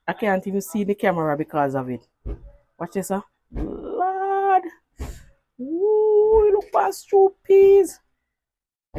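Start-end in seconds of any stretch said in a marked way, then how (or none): 3.5-8.0 excited. Real, not acted. Excited over pot of stew.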